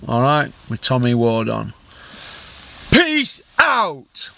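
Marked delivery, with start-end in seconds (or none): 2.9-4.3 high, shouted farewell; excited sign-off. Real, not acted. Vlogger signs off to his viewers, high excited shout. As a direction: send them off with a burst.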